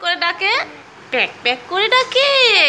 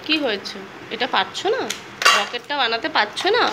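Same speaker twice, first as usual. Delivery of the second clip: hushed, lower tender questions; 7 semitones lower, 6 dB quieter than usual. Real, not acted. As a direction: lower and softer, gentle coaxing. Mother soothes crying child.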